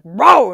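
high loud dog bark; loud imitated bark. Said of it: "he'll be like".

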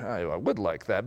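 low, soft aside; quiet admission. Retold or imitated. Father admitting interest in parkour, quiet admission. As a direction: admit it softly, pitched right down. Recalls his own tentative interest.

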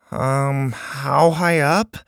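put-on hesitant, slow nervous boy. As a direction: ask it timidly, dreading the answer. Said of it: "Bo asked nervously".

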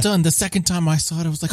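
usual speaking voice

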